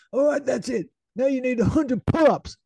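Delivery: high, loud hammed-up command; mock Goggins order. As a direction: order it like a drill sergeant. Goggins ordering pull-ups.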